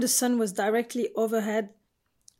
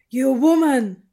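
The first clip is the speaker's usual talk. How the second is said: loud, higher accusing shout; 4 semitones higher, 10 dB louder than usual. A narrator's reading. Teresa at breakfast accuses Jess, loud shout. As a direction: hurl it across the breakfast table. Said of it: "Teresa shouted at breakfast".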